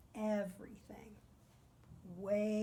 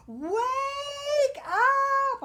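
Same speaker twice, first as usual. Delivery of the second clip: full-throated shout, much higher and louder; yelling wake up; 12 dB louder than usual. Retold or imitated. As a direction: bellow it across the campus quad. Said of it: "is yelling at the top of his lungs".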